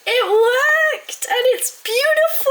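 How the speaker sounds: very high, drawn-out triumphant cry; delighted squeal. Real, not acted. Vlogger whose melted chocolate finally worked, delighted high squeal.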